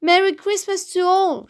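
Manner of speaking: high, ringing holiday shout. A narrator's reading. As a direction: shout good wishes to everyone. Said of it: "shouted Froggy".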